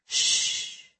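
soft whispered shh; soothing hush. Acted. Dying woman hushes the weeping boy, soothing whisper. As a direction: hush him gently like a crying child. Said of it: "she soothed".

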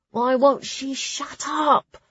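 high, slow, drawn-out whine; impatient complaint. A narrator's reading. Clara fed up with the abbess's speech, impatient complaint. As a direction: complain with long, exasperated words.

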